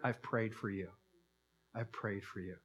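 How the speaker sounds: tender reassurance. Retold or imitated. Jesus comforts Peter.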